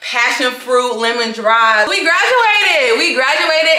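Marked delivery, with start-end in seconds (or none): 1.9-3.7 excited. Real, not acted. Joking about cocktail upgrade.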